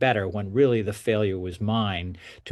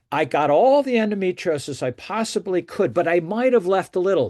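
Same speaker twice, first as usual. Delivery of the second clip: mock breezy doctor; 8 semitones higher, 4 dB louder than usual. Doctor voicing past reassurance.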